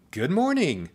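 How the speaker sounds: high, lilting welcome; friendly call. A narrator's reading. Teacher greets the new student, friendly call. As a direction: greet brightly, pitched well up. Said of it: "a friendly voice called out".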